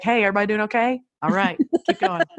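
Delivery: high call out the door; sing-song check-in yelled to the kids. Retold or imitated. Mom calling out the door to her kids, cheery and high. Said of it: "I just yell for Henley".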